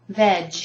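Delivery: "Veg" alone as a crisp vej; "Veg" as a separate teaching chunk; isolated syllable. Shown as a demonstration. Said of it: "I break it down completely".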